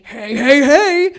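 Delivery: loud cartoon catchphrase, climbing in pitch; Fat Albert. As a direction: belt out a rising catchphrase impression. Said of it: "Who is she, Fat Albert?".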